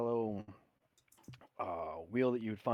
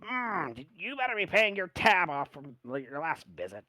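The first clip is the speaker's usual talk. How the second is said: sassy gnome barkeep; 7 semitones higher, 3 dB louder than usual. Acted. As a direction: scold him about the tab, playfully. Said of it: "he goes".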